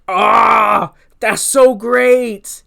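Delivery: loud, drawn-out delighted cry; thrilled fan reaction. Retold or imitated. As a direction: let the fan joy burst out. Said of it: "which was like".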